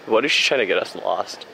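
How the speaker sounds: ordinary talk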